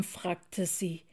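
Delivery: ordinary delivery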